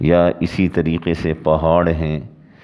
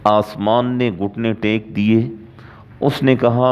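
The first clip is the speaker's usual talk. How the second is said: ordinary delivery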